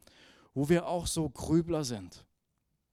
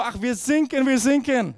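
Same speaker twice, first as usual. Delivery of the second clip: loud, higher hammed-up panic; mock panicked cry; 6 semitones higher, 7 dB louder than usual. Sailors repeat their distress call in German, loud mock panic. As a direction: shout it like a sinking sailor. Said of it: "die setzt den Funkspruch".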